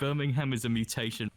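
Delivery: jokey Brummie put-on; lightly mocked Brummie accent; mock Birmingham accent.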